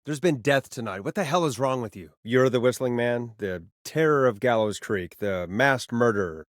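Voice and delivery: usual speaking voice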